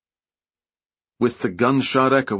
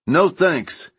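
short raised retort, pitched up; shouting; 8 semitones higher, 3 dB louder than usual. A narrator's reading. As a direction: call it back defiantly. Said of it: "Joe shouted back".

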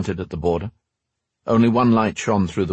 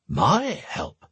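put-on surprised old man; 10 semitones higher than usual. A narrator's reading. Max surprised.

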